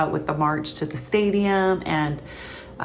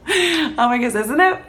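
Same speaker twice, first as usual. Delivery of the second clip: higher, through a laugh; 7 semitones higher, 4 dB louder than usual. Real, not acted. Vlogger amused by all the different pronunciations, laughing as she talks. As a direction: say it while still giggling.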